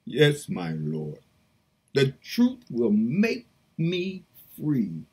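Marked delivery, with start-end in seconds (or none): none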